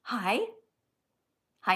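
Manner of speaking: ordinary talk, nothing marked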